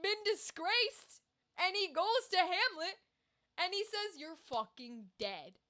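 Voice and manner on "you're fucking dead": softer, slow, low threat; quiet menace. Retold or imitated. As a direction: say it cold and slow, mock deadly. Laertes threatening Hamlet.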